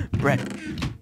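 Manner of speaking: high gasp of relief; gasp, then cry out the find; surprised gasp. Real, not acted. Finds bread while starving.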